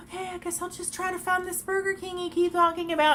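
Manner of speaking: high mock voice of the woman giving in; high put-on voice of the woman with the phone. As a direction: parody her reluctant agreement.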